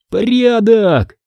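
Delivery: high, drawn-out happy cry; joyful call. A narrator's reading. Chick cheering as they reach the street, joyful. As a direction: call it out gladly at a high pitch. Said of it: "радостно крикнул Цыпа".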